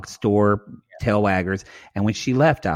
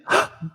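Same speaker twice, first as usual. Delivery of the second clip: sharp, short acted gasp; mock gasp. Cohost imitating her reaction to bad marriage news, mock gasp. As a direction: gasp as if shocked. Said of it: "she gasped".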